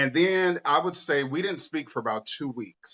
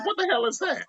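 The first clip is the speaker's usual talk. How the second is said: mom. Retold or imitated. Mom confronting about bisexuality.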